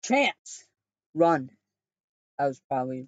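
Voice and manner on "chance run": yelled warning. A narrator's reading. Keenan warning his brother to flee, yelling. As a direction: shout the warning up the stairs. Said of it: "Keenan yelled".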